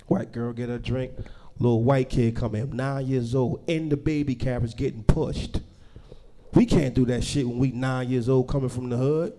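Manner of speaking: normal talking voice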